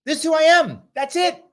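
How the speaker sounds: high, loud, defiant imitation; lightly voiced Pence standing firm; a hint of a blunt politician; mock Pence. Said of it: "I actually liked Pence better when he was just".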